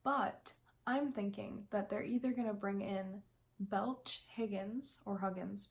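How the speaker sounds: ordinary talk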